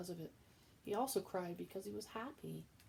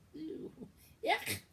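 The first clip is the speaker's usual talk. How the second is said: disgust; 11 semitones higher, 6 dB louder than usual. Acted. Cook disgusted by mouse.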